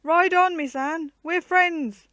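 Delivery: high, loud, cracking yell to a rider; cracked yell. A narrator's reading. Guy yelling to Anne as she rides past, cracked loud yell. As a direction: shout her on, voice breaking. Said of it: "In a cracked voice, Guy yelled".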